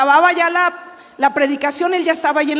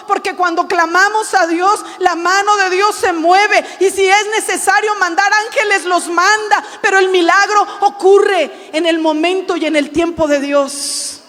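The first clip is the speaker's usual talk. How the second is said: normal talking voice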